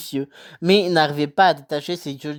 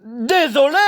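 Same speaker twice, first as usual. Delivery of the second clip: sharp bark, pitched much higher; 10 semitones higher, slower than usual. Acted. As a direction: snap the word Désolé back at him. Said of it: "aboya Harry".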